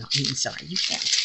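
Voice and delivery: normal talking voice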